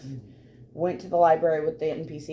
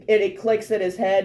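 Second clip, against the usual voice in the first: ordinary delivery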